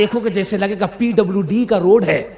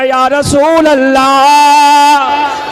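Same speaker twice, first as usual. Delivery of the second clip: very loud, long-drawn high call; devotional cry; 5 semitones higher, 10 dB louder, much slower than usual. Real, not acted. Preacher leading the crowd's raised-hands cry, long loud call. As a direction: stretch the invocation out at full volume.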